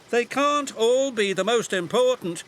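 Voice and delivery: high, frustrated little voice; high exasperated digger. A narrator's reading. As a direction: protest in a high voice.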